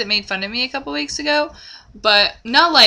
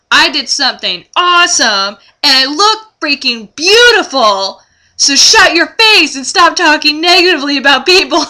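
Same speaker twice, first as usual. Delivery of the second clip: louder, rising, slowing to a half-laughing blast; imagined telling-off; 5 semitones higher, 8 dB louder than usual. Pretend. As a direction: tell her off, building to a laughing shout. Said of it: "turn around to be like".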